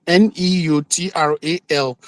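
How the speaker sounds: N E U T R A L spelled letter by letter, slowly. Shown as a demonstration. Spelling out neutral to make the point, slow and deliberate. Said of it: "emphasis on the word neutral person".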